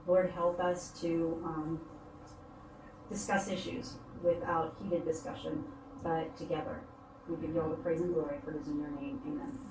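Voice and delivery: soft, earnest praying voice; prayer. Acted. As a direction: pray quietly and sincerely. Leads opening prayer at meeting.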